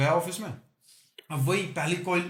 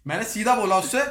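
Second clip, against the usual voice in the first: ordinary delivery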